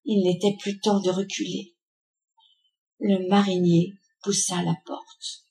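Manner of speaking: usual speaking voice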